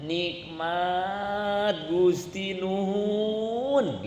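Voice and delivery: slow, soft, thankful "Nikmat Gusti Nuhun"; quoting the teacher's grateful words in pain; grateful murmur. Retold or imitated. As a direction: breathe out thanks through the pain. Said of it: "apa yang keluar dari mulutnya".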